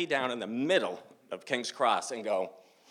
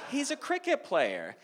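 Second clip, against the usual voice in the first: high-pitched, insistent disbelief; exasperated explaining; 13 semitones higher than usual. Retold or imitated.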